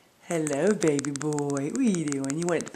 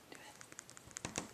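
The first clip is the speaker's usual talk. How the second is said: whispering; 21 dB quieter than usual. Real, not acted. Urging bird quietly.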